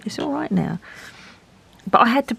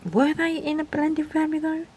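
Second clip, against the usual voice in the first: joking high voice; 9 semitones higher than usual. Real, not acted. Joking about blending families.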